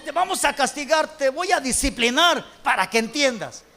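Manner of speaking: mock parental scolding. Parent to a child who won't understand, mock stern threat. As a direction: play the fed-up parent laying down discipline.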